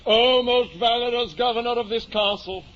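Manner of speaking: high, lofty knightly plea; grand pleading. Acted. Don Quixote kneeling to the innkeeper, lofty pleading. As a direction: beg like a knight on his knees.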